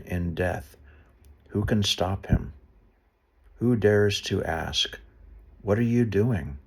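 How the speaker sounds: measured, earnest Bible reading; a solemn read of Job, lightly heightened; scripture reading. Acted. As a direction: read the passage out to listeners. Reads scripture aloud, plain asides elsewhere.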